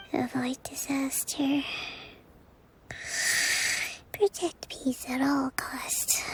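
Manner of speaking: murmured whisper, half asleep; sleep talking. Acted. Dozing leader whispering in her sleep, murmured.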